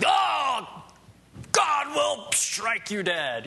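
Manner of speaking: high, loud drawn-out holler; yelling and screaming. Acted. Preacher mimicking harsh evangelism to warn listeners against it, yelling and screaming. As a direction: bellow it like a hellfire preacher. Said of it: "yelling and screaming and, and saying".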